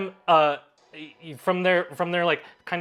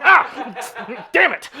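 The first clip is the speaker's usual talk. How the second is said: startled yelp; 9 semitones higher, 8 dB louder, much slower than usual. Acted. Dragonborn slapped by tendril.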